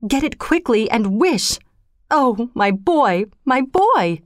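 restrained anguished cry; shouting. A narrator's reading. Wife begging to have her boy back, mildly shouted plea. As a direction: draw out the plea with mounting urgency. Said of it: "she shouted".

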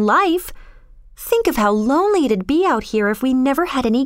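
put-on indignant, then breezily untroubled girl; defensive young girl. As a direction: argue it lightly in a high girl's voice.

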